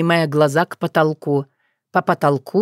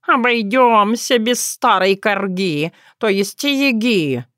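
slow, drawn-out cat; talking cat; 3 semitones higher, slower than usual. Acted. Cat declining granny's visit, slow and drawn-out. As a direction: stretch it out as a cat covering a slip.

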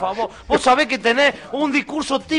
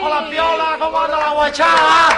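brash barrio greeting; cumbia villero character; 6 semitones higher than usual. Acted. Andy character arrives shouting.

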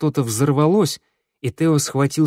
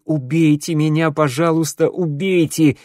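higher, louder begging cry; desperate wail; 5 semitones higher, 4 dB louder than usual. Acted. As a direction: scream it, hanging on for dear life. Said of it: "он завопит".

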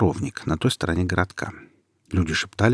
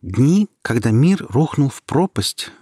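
slow, louder solemn recitation; reciting by heart; 6 semitones higher, 4 dB louder, slower than usual. Acted. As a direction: declaim it like a memorized lesson. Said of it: "продекламировала Ника заученные наизусть".

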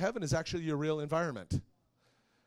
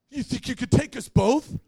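mocking bully challenge; 8 semitones higher, 11 dB louder than usual. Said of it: "And the guy goes".

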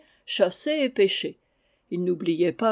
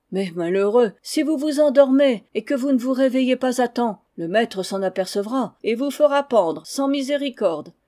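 put-on higher, louder worried servants against a confident boy; alarmed servants; 4 semitones higher, 5 dB louder than usual. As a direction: warn him of the gallows, then brush it off. Valets inquiets, Goulven assuré. (dialogue animé, voix plus haute et plus forte)

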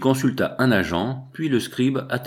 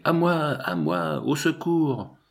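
high, drawn-out call for help; cri d'appel au secours; 6 semitones higher, slower than usual. A narrator's reading. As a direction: call out slowly on a high pitch, not truly yelling. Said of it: "je criai". (appel au secours aigu et traînant)

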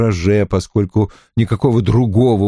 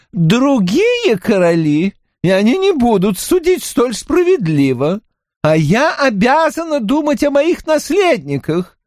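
countess voice; 11 semitones higher than usual. Acted. Mahaut continues refusal.

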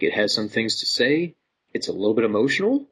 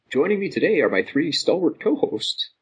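usual speaking voice

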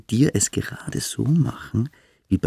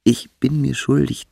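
ordinary delivery

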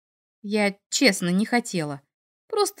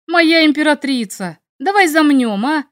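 higher and louder coaxing shout; loud call; 5 semitones higher, 7 dB louder than usual. A narrator's reading. Dragon shouting to the heroine to drop it, loud call. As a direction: call out to make peace. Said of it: "крикнул Мик довольно громко".

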